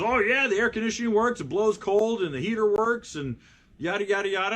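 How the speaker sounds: mock the seller's sales pitch, pleased with himself; hammed-up proud dad listing what works; proud car owner. Owner boasting about his car, voiced.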